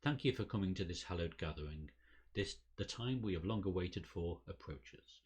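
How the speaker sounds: ordinary talk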